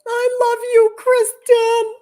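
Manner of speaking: high, loud gushing to a viewer; gushing love. Real, not acted. Host gushing back at Kristen, a viewer, high and loud. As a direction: squeal your love for her.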